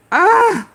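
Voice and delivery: high, loud groan of impatience; exasperated groan. Real, not acted. Streamer fed up waiting on slow people, exasperated groan. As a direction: let out a rising, fed-up ugh.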